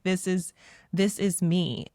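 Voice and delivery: ordinary delivery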